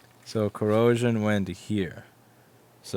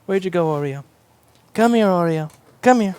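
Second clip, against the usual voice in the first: much higher and louder, calling the kitty; high cat-calling voice; 10 semitones higher, 9 dB louder than usual. Real, not acted. Owner calling cat Oreo over, high and loud. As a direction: sing out to the cat to come.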